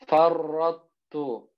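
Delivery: slow drawn-out Quranic recitation of Farrotu; tajwid recitation. Shown as a demonstration. Teacher demonstrating to students, slow recitation. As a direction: chant Farrotu slowly, letters merged. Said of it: "Ahal tu".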